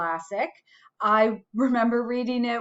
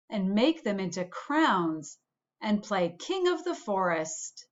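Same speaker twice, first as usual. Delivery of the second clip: put-on storytelling for kids. A narrator's reading. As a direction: read it like bedtime story time for the class. Reads a picture book aloud.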